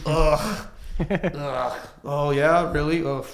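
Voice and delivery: loud, exaggerated disgusted ughs; hammed-up contempt with a sarcastic "Oh, yeah? Really?"; disgusted groans. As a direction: groan at him like it makes you sick. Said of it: "he's just like".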